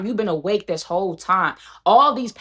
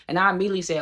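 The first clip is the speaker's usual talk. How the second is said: usual speaking voice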